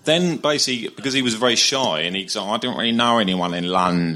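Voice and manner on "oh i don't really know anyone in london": shy mumbling whiny voice; [name] impression. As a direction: imitate a shy whiny musician. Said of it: "That's very good".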